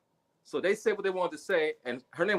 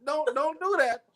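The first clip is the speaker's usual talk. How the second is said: playful protest; 9 semitones higher, slower than usual. Retold or imitated. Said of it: "I turned around smiling".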